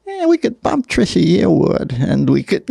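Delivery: lightly voiced Leno, laughing; Leno impression. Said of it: "And he's like".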